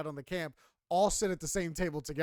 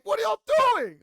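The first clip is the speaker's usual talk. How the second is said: much higher, louder disbelief; exasperated outburst; 12 dB louder than usual. Real, not acted. Reacting to staff gossiping near campers, incredulous outburst. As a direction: blurt it out, high and incredulous.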